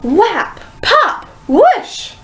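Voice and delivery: very high and punchy; excited sound words. A narrator's reading. Narrator voices exploding hair, very high and excited. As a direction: burst each word out high.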